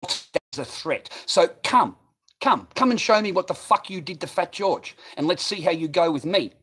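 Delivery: loud, high-pitched angry challenge; reenacted confrontation at full volume; retold threat. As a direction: loudly goad a bigger man into a fight. Retold threat to bigger man.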